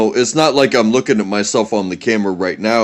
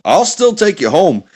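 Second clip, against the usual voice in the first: higher-pitched dad laying down the law; stern lecturing dad; 5 semitones higher than usual. Acted. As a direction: scold a kid in a no-excuses dad voice. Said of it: "you seem like you're this type of dad".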